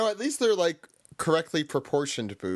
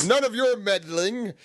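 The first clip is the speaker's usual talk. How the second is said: lightly voiced smug villain; a hint of a gloating villain; mustache twirling Doflamingo; 6 semitones higher than usual. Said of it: "I'm literally twirling my mustache right now".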